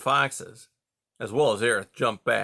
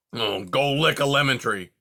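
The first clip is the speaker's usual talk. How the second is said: irritated porcupine, a bit louder; snapping; 4 dB louder than usual. Acted. Porcupine snaps at whining fox, irritated. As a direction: shut him down sharply. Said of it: "Era snapped".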